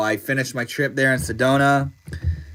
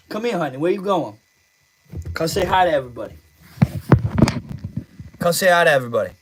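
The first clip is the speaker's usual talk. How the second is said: higher pet-talk voice coaxing the kitty; talking to the cat; 4 semitones higher, faster than usual. Real, not acted. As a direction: coax the cat over sweetly. Calling the cat to the camera.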